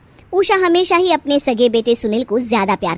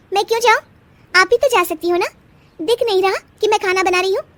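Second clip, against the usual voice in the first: higher, snappy refusal; defiant young wife; 8 semitones higher than usual. Acted. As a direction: push back hard. Bride refuses errand.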